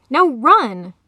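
loud command to flee; sharp, forceful shout; urgent yell. A narrator's reading. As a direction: yell it to get them moving. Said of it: "she yelled".

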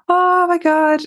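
high, loud burst of amazement; sudden loud high exclamation; exclaimed oh my God. Retold or imitated. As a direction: blurt it out at the memory of the ice cold. First solo ice bath, recalling shock.

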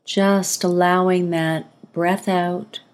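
calm breathing-exercise guidance, spaciously paced; guided meditation. Acted. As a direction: ease the listener into relaxing, phrase by phrase.

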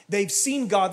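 ordinary talk, nothing marked

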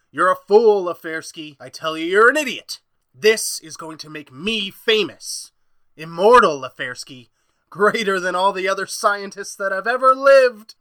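loud, contemptuous rant; scornful tirade. Acted. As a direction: berate him, then gloat. Doc berates and boasts.

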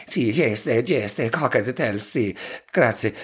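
slow hammed-up Italian accent; mock Italian accent. Shown as a demonstration. Answering the barman in mock Italian accent. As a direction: drag out the comic Italian accent. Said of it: "order your drinks in a northern Italian accent".

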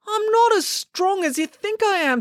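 high-pitched, desperate protest; strained protest. Acted. Joe holding his slipping brother, strained protest. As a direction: plead that you can't, voice pushed high.

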